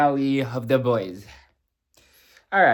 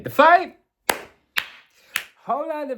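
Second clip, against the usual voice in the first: excited; 12 semitones higher than usual. Real, not acted. Excited fan recalling the fight.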